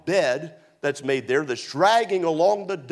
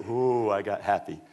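deacon's reply; 8 semitones lower, 3 dB quieter than usual. Retold or imitated. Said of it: "He said".

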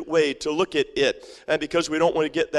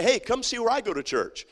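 proud invitation; 6 semitones higher, 3 dB quieter than usual. Pretend. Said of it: "people will be able to say".

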